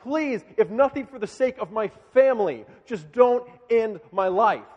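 loud, high retold plea for his life; screaming plea. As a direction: cry out imitating a condemned man begging. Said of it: "he is just screaming for his life".

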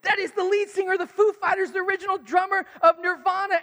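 urgently filling friends in; thrilled fan explaining; excited explaining. Retold or imitated. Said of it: "I had to explain".

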